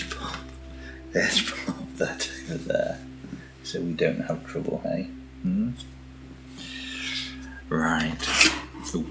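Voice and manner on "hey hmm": normal talking voice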